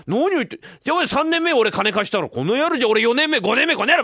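high, loud, overlapping-style squabble; escalating quarrel. Acted. Two friends bickering over old loans, angry and loud. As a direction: trade accusations, building to a yell.

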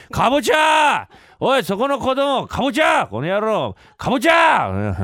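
high, drawn-out hollering at passers-by; pushy, high-pitched vendor shout; bellowed street cry. Acted. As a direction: holler it at a child like a bad vendor. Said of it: "かぼちゃって怒鳴りゃいいのか".

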